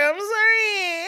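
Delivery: very high, loud, drawn-out mock cry; hammed-up sobbing plea, stretched into a wail; Alex, wailing apology. Wife as husband stares at her, mock tearful wail. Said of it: "She'd be like".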